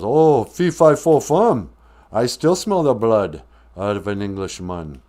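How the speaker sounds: put-on loud giant roaring in an English accent. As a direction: boom the chant in a mock English accent. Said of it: "Why do you have an English accent".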